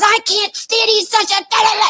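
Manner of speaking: high-pitched mock tirade trailing into "da, da, da"; hammed-up angry caller; imitated cursing rant. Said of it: "she's cursing the shit out of it".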